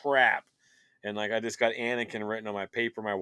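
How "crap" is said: high, loud burst of shock; excited. Retold or imitated. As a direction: blurt it out in total disbelief. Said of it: "And I was like".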